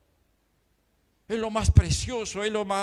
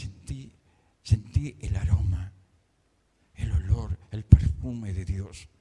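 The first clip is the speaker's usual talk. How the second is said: low hushed wonder; awed reverence; 10 semitones lower than usual. Real, not acted. Preacher recalls smelling God's perfume, in hushed awe. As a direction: let the voice sink low, savoring each phrase.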